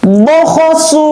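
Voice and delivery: high, crisp Quran letters; fast, firm recitation. Shown as a demonstration. Teacher shows pupils fast, firm letter reading, high and crisp. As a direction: snap out each syllable firmly. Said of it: "Cepat, tegas".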